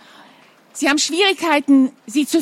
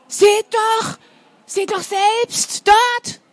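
high, slow, pointing urgently; see for yourselves, pitched high; high-pitched flustered teacher; agitated teacher; 9 semitones higher, much slower than usual. Acted. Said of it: "erklärt Frau Jansen".

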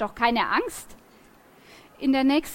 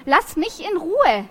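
loud, high and drawn out; pained outcry; 6 semitones higher, 5 dB louder, slower than usual. Acted. Girl in pain shouting at the boy with the gun, loud and slow. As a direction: cry out in pain to be left alone. Hurt girl fends off attacker.

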